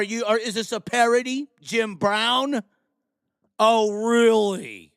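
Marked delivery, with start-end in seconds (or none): 3.6-4.9 slow, stretched mock surprise; drawn-out sarcasm. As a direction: drag out the oh, sarcastic. Sarcastic reply to Jim Brown.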